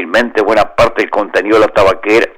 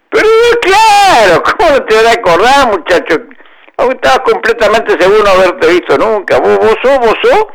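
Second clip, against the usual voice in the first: lightly voiced tiger, higher than narration; tiger character; 11 semitones higher, 3 dB louder than usual. A narrator's reading. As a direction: play the tiger faking recognition.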